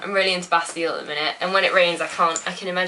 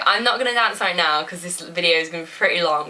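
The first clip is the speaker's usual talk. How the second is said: ordinary talk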